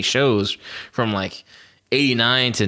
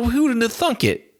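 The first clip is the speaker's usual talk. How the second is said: astonished; 12 semitones higher than usual. Retold or imitated. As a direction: marvel at the find. Retold astonished reaction.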